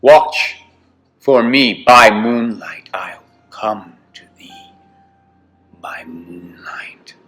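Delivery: plain reading voice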